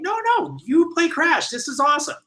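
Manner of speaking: very high, enthusiastic mock producers; excited insistence. Producers insisting the writer play Crash, excited. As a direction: imitate bosses eagerly overruling him.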